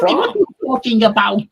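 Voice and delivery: mock German, a touch high; hamming the German; German accent. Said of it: "with German accents".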